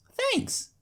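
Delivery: put-on high bright little-girl chirp; chirpy filly. Apple Bloom thanking the doctor, chirpy. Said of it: "she chirps".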